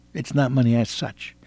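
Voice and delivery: ordinary delivery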